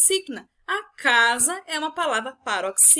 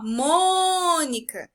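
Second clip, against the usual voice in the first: MÔNICA called out with a long loud MÔ; slow drawn-out calling of Mônica, strong on MÔ; 2 semitones higher, 4 dB louder, much slower than usual. Shown as a demonstration. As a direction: call "Mônica", stretching the first syllable. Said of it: "estou chamando a Mônica".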